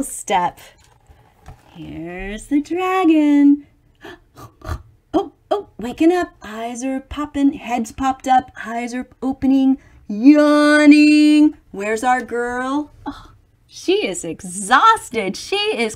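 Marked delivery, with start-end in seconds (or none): 4.4-6.3 gasp, then a higher "oh, waking up"; startled as it stirs; sudden wide-eyed gasp; gasping surprise. Acted. Dragon waking up in picture. 10.1-11.6 loud mock yawn. Dragon yawning.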